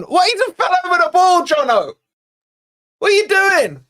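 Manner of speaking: high, fast yelling in disbelief; can't believe what he just saw; exasperated shout. Real, not acted. Fan yelling at Jono for falling over the ball, outraged shout.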